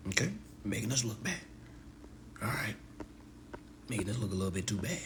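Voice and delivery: soft, quick, discreet telling-off; hushed reproach. Retold or imitated. Member told they make the group look bad, hushed reproach. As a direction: quietly take them aside. Voices someone discreetly scolding.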